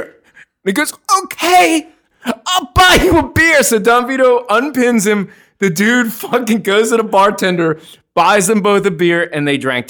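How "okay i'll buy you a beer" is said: high strangled agreement; mock squeaky giving-in; imitated choked surrender. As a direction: squeeze out a yes while throttled. Pinned man giving in.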